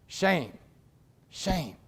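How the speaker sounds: hushed, falling single word; somber. Real, not acted. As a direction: let it drop softly, with weight. Somber comment on his own headline.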